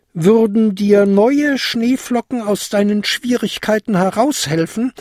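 high-pitched, probing question; pondering old lady. A narrator's reading. Old lady offering goblin new snowflakes, pondering.

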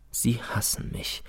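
slow, breathy murmur; soft, slow hushed line; bitter whisper. Acted. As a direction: breathe it out in dread. Teacher's bitter realisation.

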